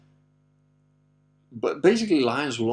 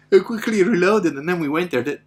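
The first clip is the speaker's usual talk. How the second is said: ordinary talk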